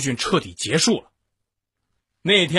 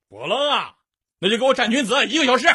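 stern instructor; 8 semitones higher, faster than usual. Retold or imitated. Instructor punishes recruits.

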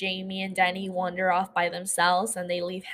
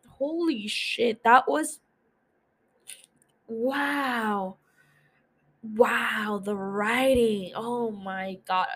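awed; 2 semitones higher, much slower than usual. Real, not acted. Stunned by the writing.

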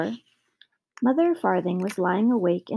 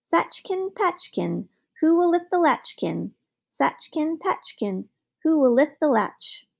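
high, ringing little magic man; thin, clear voice; 6 semitones higher than usual. A narrator's reading. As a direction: lilt it high like a silver bell. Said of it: "thin and clear as the ring of a silver bell".

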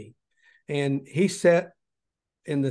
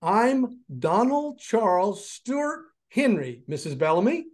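gentle giant voice; 6 semitones higher than usual. Retold or imitated. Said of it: "with that big, deep voice of his".

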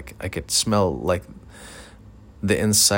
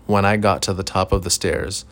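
usual speaking voice

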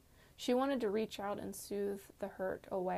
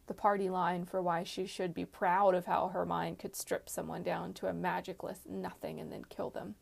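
usual speaking voice

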